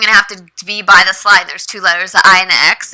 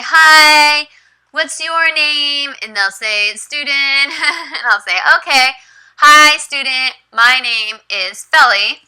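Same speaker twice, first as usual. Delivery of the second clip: high, slow, friendly classroom greeting; teacher greeting; 5 semitones higher, slower than usual. Shown as a demonstration. Teacher greets her young student slowly and brightly.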